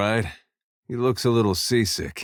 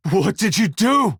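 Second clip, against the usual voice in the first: high, raised-voice demand; horrified outcry; 11 semitones higher than usual. Acted. Confronting someone over what they did, horrified outcry. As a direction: cry it out in shocked disbelief.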